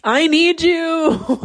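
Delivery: put-on high, drawn-out child's wail; needy inner child. Inner child begging the overwhelmed adult for attention, high needy wail. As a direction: stretch it out like a pleading little kid. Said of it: "my inner child's like".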